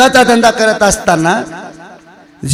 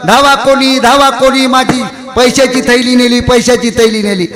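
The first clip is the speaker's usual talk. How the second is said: mock cry for help; 7 semitones higher than usual. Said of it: "हे ओरडू लागलं".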